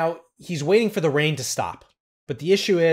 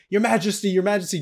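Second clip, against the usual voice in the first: retold yell, higher and a bit louder; mock yell; 6 semitones higher, 3 dB louder, faster than usual. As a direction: call out to the emperor in a mock shout. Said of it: "he yells to Napoleon".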